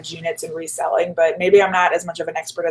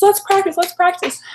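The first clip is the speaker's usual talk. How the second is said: Jackie pushing; 12 semitones higher, 4 dB louder than usual. Retold or imitated. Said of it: "She says".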